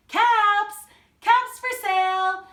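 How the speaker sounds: high, loud street peddler's call; shouting. A narrator's reading. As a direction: call out your wares to the whole town.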